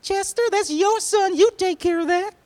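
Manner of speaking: very high, loud mom yell; high-pitched scolding mother voice; exasperated mom. Acted. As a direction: shriek it at your husband, handing off the kid. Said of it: "She said".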